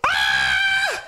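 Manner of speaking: sudden low piercing scream; a loud labour cry, ahh; wife's scream. Retold or imitated. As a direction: let out a birth scream. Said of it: "le cri qu'elle a poussé".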